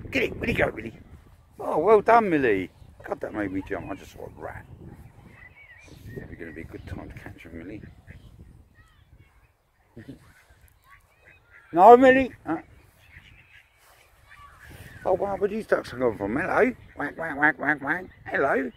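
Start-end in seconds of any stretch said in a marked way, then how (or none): 0.4-0.9 eager call to the dog. Real, not acted. Asks the dog about the rat. 1.6-2.7 high, loud praise burst for the dog; loud excited praise. Real, not acted. Praising the dog after rat chase. 11.7-12.6 high, loud warning yell at the dog; sharp loud call. Real, not acted. Stopping the dog. 15.0-18.7 high, loud amazed chatter at the ducks; excited surprise. Real, not acted. Ducks swarming toward them.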